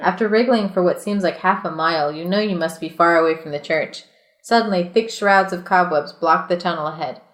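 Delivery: steady read-aloud storyteller voice; reading the adventure book aloud to friends, measured narration; story narration. A narrator's reading. Reads gamebook narration aloud.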